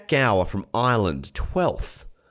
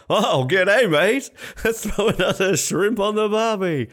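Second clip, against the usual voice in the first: hammed-up Aussie accent, pitched much higher and louder; mock Australian accent; 13 semitones higher, 4 dB louder, slower than usual. Podcast host teasing the Austria accent mix-up, broad mock Australian accent. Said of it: "That's a lovely accent you've got there".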